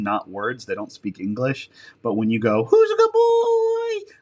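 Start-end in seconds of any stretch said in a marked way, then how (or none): 2.6-4.1 very high, drawn-out doggy praise; happy praise voice. Shown as a demonstration. Handler making a dog's ears perk up, happy praise voice. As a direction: sing it out high, louder, slow and delighted. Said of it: "they know it's a happy sound".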